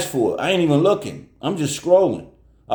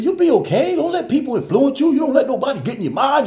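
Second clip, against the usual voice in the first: much higher, earnest pep talk; reassuring uncle; 13 semitones higher, 3 dB louder than usual. Retold or imitated. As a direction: encourage your crying niece. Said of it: "I, I said".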